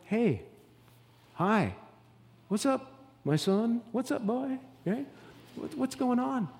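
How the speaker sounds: mock casual hey from Jesus; nonchalant Jesus. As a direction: say it offhand, like no big deal. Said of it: "it's so nonchalant".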